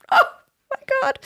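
high, breathy oh my God; moved and overwhelmed. Real, not acted. Overwhelmed reading emotional email.

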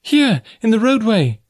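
high-pitched call to someone far off. A narrator's reading. Girl guides her rescuer to the roadway, calling out. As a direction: call out your position. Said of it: "she called in reply".

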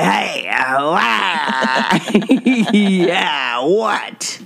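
lightly voiced drunk truck driver. Shown as a demonstration. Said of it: "I talk like a drunk truck driver".

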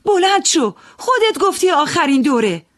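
high, loud command; loud high command to the gambler to get up. Acted. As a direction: order him up at full voice. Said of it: "با صدای بلند گفتم".

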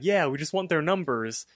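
ordinary talk, nothing marked

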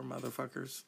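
ordinary talk, nothing marked

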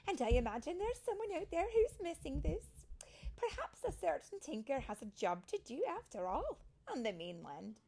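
high, loud, lilting hint; hint coyly at a special job; animated storybook character voice; knowing elder fairy. A narrator's reading. Fairy Mary hints at a mission.

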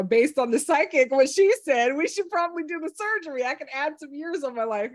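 high, loud retold husband; husband's voice. Husband quoted deciding surgery.

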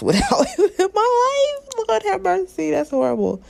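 high, helpless laughter through the words; laughing. Real, not acted. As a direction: crack up while talking, pitch soaring. Breaks up laughing at own remark.

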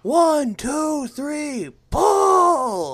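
loud count to pull; hauling a rope. Pretend. Mock counting a rope haul.

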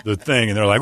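ordinary talk

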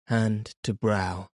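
usual speaking voice

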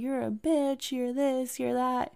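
ordinary talk

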